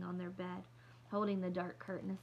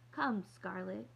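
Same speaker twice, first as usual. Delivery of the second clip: ordinary delivery, nothing marked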